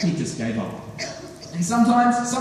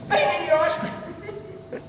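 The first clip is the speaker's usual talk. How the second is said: much higher, slow squeaky plea; hammed-up desperate lie; 12 semitones higher, slower than usual. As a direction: squeak out the false oath, slowly. Swearing a desperate lie to friend.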